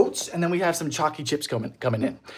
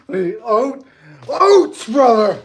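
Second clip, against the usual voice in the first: mock call; 5 semitones higher, 9 dB louder, much slower than usual. Calling out for oats.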